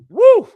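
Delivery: a sudden high, loud woo as a joke; mock cheer. Coach mocking a husband's delight, sarcastic whoop. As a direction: whoop like the best news ever.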